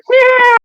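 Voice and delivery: very high squeaky meow; a tiny fire monkey squealing; squeaky creature cry. Acted. Chimchar cries out.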